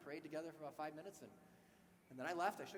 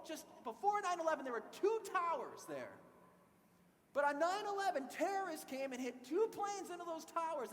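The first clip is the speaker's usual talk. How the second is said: raised, higher voice pressing the point hard; heated debate with the young man, raised voice; heated argument; 10 semitones higher, 7 dB louder than usual. Retold or imitated. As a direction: argue it louder and higher, getting heated. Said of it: "things are really getting heated".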